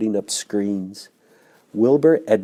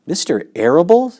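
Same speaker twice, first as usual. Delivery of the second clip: high, louder tearful cry; a piglet's sobbing question; sobbed; 9 semitones higher, 5 dB louder than usual. Acted. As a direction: sob the name out in disbelief. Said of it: "sobbed Wilbur".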